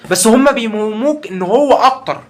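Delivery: high, slow sneering tone; mocking drawl. Real, not acted. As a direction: drag it out scornfully. Mocking the deceivers' claim. (سخرية ببطء)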